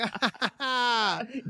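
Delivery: high, gleeful laugh; mischievous laugh; high cackle. Retold or imitated. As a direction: cackle like you got away with it. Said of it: "I'm like".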